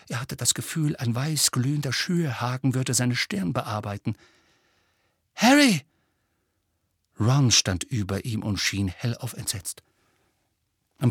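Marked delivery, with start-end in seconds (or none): 5.3-5.8 high, loud cry to wake someone; urgent calling. Acted. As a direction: call out urgently to a dreaming friend. Friend calls to wake dreamer. (dringend, laut gerufen)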